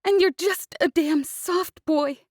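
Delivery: tearful outburst; sobbing. Acted. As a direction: sob out the words. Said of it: "she sobbed".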